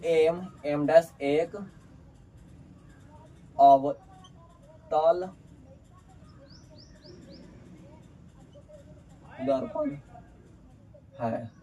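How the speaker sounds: word-by-word dictation with long pauses; drawn-out words, widely spaced; slow dictation. Real, not acted. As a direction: dictate slowly, as if writing each word out. Dictates while writing.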